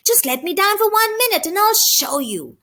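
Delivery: dog begging its owner to put him down; yapping terrier. A narrator's reading. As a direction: yap it like a terrier being carried off. Dog pleading as carried away.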